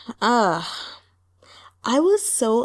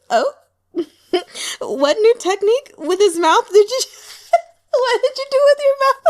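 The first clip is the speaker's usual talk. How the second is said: high, loud, giggling innuendo; laughing high tease; 13 semitones higher, 5 dB louder than usual. Retold or imitated. Teasing a character about her mouth, laughing high and loud. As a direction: squeal it through laughter, scandalized. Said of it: "I was just like".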